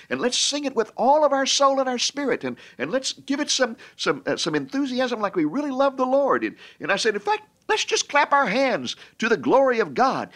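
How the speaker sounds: high-pitched, eager rallying call; rousing exhortation. Retold or imitated. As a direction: rouse the crowd to sing along. Rallying a congregation to sing.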